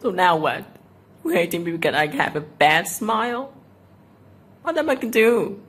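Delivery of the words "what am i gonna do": high exasperated cry. Real, not acted. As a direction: throw up your hands. Upset at insults about smile.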